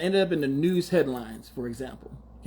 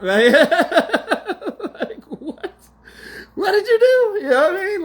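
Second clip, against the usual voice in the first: shocked squeal; 12 semitones higher, 6 dB louder, slower than usual. Real, not acted. Shocked at the imagined confession.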